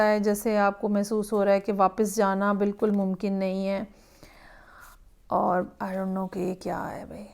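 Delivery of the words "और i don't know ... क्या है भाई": soft unvoiced aside; whispered to the viewers. Real, not acted. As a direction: breathe it out as a puzzled aside. Aside to viewers while reading.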